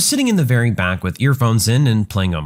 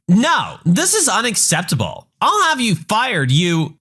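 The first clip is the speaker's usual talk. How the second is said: much higher, outraged mom threatening; angry entitled mother; 8 semitones higher than usual. Acted. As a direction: pitch it up into indignant fury. Mom threatens to get him fired.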